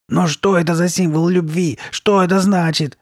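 high, loud pressing questions; insistent. A narrator's reading. Girl not letting the shopkeeper be, insistent demand. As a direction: demand an answer urgently. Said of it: "не отставала Карли Бет".

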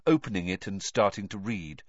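plain reading voice

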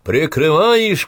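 high, loud call into the distance; shouting. A narrator's reading. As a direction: shout orders across the dark. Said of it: "крикнул Иванцов в темноту".

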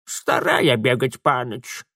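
lightly voiced toothless old woman; slow mumbled old crone words; toothless mumble. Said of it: "прошамкала старуха". (беззубо шамкая)

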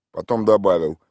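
plain reading voice

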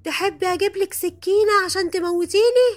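high, loud, frantic yelling; screamed outburst. Retold or imitated. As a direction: yell it high-pitched in full fury. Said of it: "وعمالة تزعق وتقول". (صراخ بصوت عالي وحاد)

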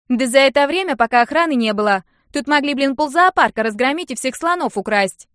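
loud, high scoffing; Нинка mocking the late guards, loud and high scorn; scornful rant. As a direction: sneer at the useless guards.

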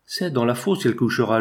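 plain reading voice

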